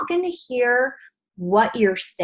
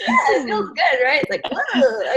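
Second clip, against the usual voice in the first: words through real laughter, higher; laughing; 5 semitones higher than usual. Real, not acted. Coach laughing with the group after shaking up energy. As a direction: still giggling as you talk.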